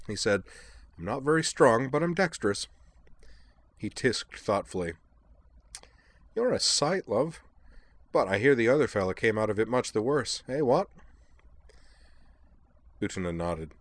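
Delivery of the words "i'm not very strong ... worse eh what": higher, breezy English chap; chirpy and self-pleased; a light eccentric gentleman; jaunty Devlin voice. Acted. Devlin's dialogue.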